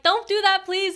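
imitated urgent pleading. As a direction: act out begging someone to stop. Begging the stranger not to jump.

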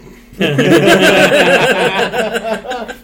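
big burst of laughter; loud laugh. Real, not acted. Laughing at London joke.

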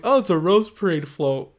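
light up as it dawns on you; delighted realization. Retold or imitated. Spotting a float at night, delighted. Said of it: "And I'm like".

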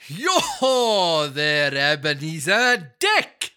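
very slow, booming Welsh greeting; a fat, jolly Welshman calling out; jovial Welsh. Acted. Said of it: "oily, rich, fat, jovial Welshman".

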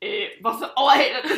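loud mock-bewildered question, breaking into laughter; baffled protest. Overtaken cyclists answering the caller, loud comic bafflement. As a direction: throw it back loudly, as if baffled.

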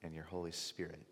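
soft, low prayer voice. Acted. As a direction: pray quietly and reverently. Closing prayer before sermon.

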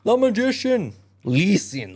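very high, loud proclamation; commanding tomcat. A narrator's reading. Tomcat orders the grain cutters to listen, high proclamation. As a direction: call it out high and grand.

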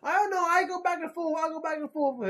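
squeaky falsetto, way up high; mock high voice. Answering how much he hates Kevin Smith, in a squeaky falsetto. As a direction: toss it off in a silly high voice.

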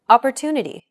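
Opportunity with TU long and stressed. Shown as a demonstration. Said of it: "One last time".

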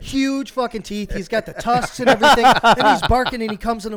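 scared storyteller describing the barking pig; excited retelling. Retold or imitated. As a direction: relive the scare.

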